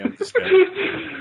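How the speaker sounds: squeaky laugh breaking through the words; real laughter over the line. Real, not acted. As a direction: giggle it out, slowly. Cracking up after a joke.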